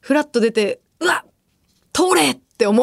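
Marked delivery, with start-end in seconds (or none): 1.0-2.3 high blurted fake Kansai-ben; Kansai intonation. Host acting out her own slip, fake Kansai intonation. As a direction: let a borrowed Kansai lilt slip out. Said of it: "イントネーションつられちゃうんだよね".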